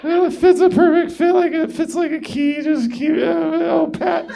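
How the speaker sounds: high, very loud, near-shouted moans; moaning woman in bed. Retold or imitated. Comic mocking the woman climaxing, high loud moans. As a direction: build to a loud high moan. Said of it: "It's like".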